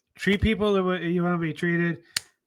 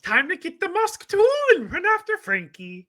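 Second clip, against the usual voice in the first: high-pitched cartoon mouse teasing; playful mascot impression; Mickey Mouse; 3 dB louder than usual. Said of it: "he hated the Mickey Mouse".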